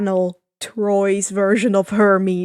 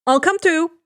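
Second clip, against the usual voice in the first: reluctant offer; 5 semitones higher, 6 dB louder than usual. A narrator's reading. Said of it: "Percy reluctantly offers".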